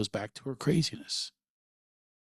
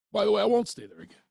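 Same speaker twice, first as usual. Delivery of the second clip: jokey aside; 10 semitones higher, 7 dB louder, much faster than usual. Real, not acted. Joking aside about mom.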